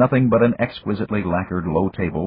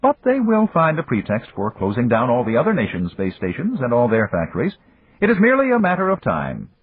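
put-on higher, measured voice of an old friend; Japanese magnate; 6 semitones higher than usual. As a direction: voice the older businessman, courteous and calm. Yamagata speaks.

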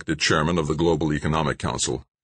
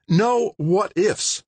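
much higher, slow and cutting; snapped; much slower than usual. Acted. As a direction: shut the question down hard. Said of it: "she snapped".

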